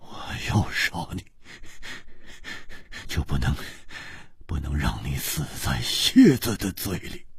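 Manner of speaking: an icy vow spoken without warmth; coldly. A narrator's reading. Bound swordsman coldly tells his rival. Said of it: "冷冷地说".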